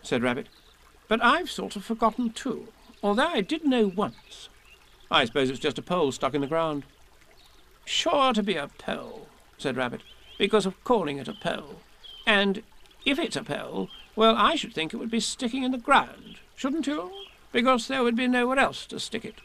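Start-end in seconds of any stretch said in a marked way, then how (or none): 1.1-9.3 Rabbit pondering importantly. A narrator's reading. 10.4-19.4 Rabbit pondering importantly. A narrator's reading.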